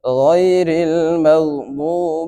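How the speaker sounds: slow melodic tajweed chant with long held endings; Quranic recitation. Shown as a demonstration. Teacher recites Surah Fatiha for the class, chanted. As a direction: sing it out as measured Quran recitation.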